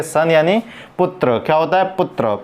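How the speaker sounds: normal talking voice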